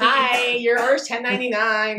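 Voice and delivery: bearded guy in a high sweet voice; mock high voice. Mocking a bearded drive-thru worker with a high voice. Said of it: "I was like".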